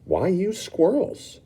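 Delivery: put-on a skeptical kid poking at the idea; Mike asking. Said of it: "Mike Teavee asked".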